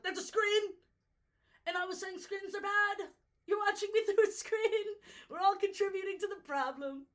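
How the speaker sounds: high, loud exclaiming; hammed-up indignation at the screen; mock outrage. Scolding viewers watching through a screen, high and loud.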